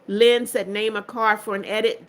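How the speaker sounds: ordinary delivery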